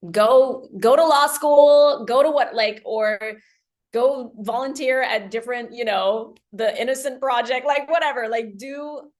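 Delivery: pep talk shouting go go go; excited cheering on. Pretend. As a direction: cheer her on like a best friend. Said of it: "what I would love for her to do is, like".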